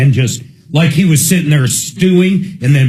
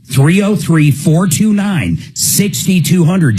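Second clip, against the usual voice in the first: ad read; 2 semitones higher, slower than usual. Acted. Ad read phone number.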